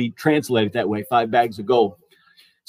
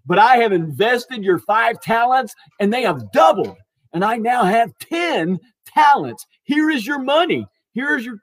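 higher-pitched, thrilled report; enthusiastic; 5 semitones higher than usual. Acted. Servant showing master the doubled money, enthusiastic. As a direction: play an ecstatic servant proudly presenting results. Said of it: "I mean, he is enthusiastic".

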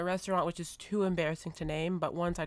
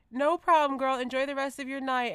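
fake polite goodbye; 7 semitones higher, 5 dB louder than usual.

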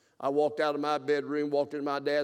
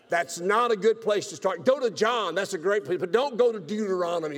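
loud, high playful warning; preacher's loud tongue-in-cheek advice; emphatic aside; 5 semitones higher, 8 dB louder than usual. Real, not acted. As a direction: raise it up like a friendly warning.

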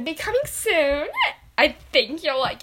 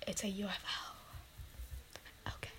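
whisper; 5 semitones lower, 21 dB quieter than usual. Acted. Whispered correction of costume.

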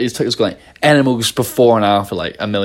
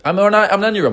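mock protest; 9 semitones higher, faster than usual. Her younger self protesting.

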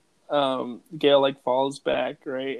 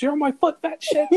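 high, snippy put-down, much higher; mock snap; 12 semitones higher than usual. [name] snapping at the cameraman who stepped on her foot, high mock snap. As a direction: bark it at the clumsy cameraman.

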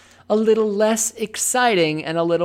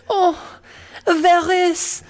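high, slow Spanish slipping into French; hammed-up Spanish-turned-French accent; botched Spanish accent; 11 semitones higher, 4 dB louder, slower than usual. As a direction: swoon in Spanish and drift into French. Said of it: "that's French".

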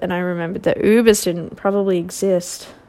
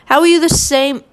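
much higher, louder incredulous question; can't believe this guy, pitched way up; exasperated disbelief; 8 semitones higher, 4 dB louder than usual. Real, not acted. Exasperated rant about fake French roots.

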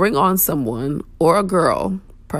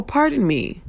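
polite pardon me; 6 semitones higher, slower than usual. Shown as a demonstration.

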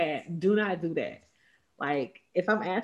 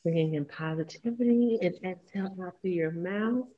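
guided breathing. Acted. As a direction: ease listeners through each breath.

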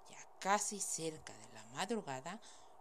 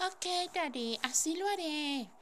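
sweet daughter voice; 10 semitones higher, 5 dB louder than usual. Acted. Daughter sweetly answering father.

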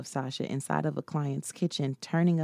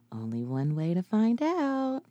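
teasing; 5 semitones higher than usual. Acted. Sasha teasing, swinging dress.

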